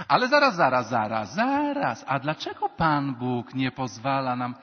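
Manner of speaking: booming mock challenge to the priest; mock indignant objection. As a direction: imitate someone butting in to object. Imitated objector interrupts the priest.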